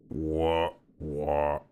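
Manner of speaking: very low, drawn-out questioning voice; low creature voice. Acted. Other crab answering the polite stranger, low creature voice. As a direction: drop right down into a slow creature voice.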